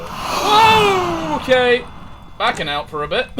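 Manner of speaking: high, loud startled yelp. Real, not acted. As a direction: jump at a scare, loud. Startled by ghost event.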